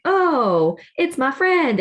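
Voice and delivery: a hammed-up cheerful hello to a friend; mock surprised greeting. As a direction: play the sudden delighted recognition, pitched well up. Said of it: "and you're like".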